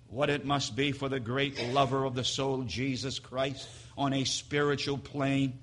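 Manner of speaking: ordinary talk, nothing marked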